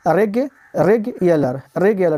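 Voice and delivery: रेगुलर broken up slowly, reg then reg-yuh-lar; slow syllables. Shown as a demonstration. Teacher slowly sounding out रेगुलर for students. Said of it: "यहाँ पे आपका जो ई है".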